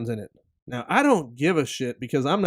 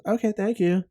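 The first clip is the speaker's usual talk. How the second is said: imitating daughter; 6 semitones higher, 3 dB quieter than usual. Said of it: "That's what she says".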